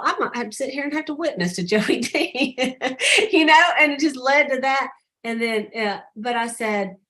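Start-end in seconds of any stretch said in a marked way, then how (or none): 0.0-4.9 real laugh breaking into the words. Real, not acted. Telling the team about having to witness to Joey, laughing. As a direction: tell it through an amused laugh, higher.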